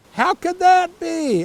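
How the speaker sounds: high, loud, slow disbelief; astonished. Real, not acted. The reader shocked by the lion on an iron, high and loud. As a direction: gasp it out in disbelief.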